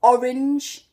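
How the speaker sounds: orange with a strong open O; Geordie orange. Shown as a demonstration. Teacher showing Geordie vowels, strong O in orange. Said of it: "and I'd say".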